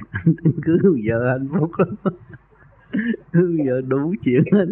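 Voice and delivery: real chuckling through the words; laughing. Real, not acted. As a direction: talk slowly through laughter. Amused while teasing about wives. (vừa nói vừa cười)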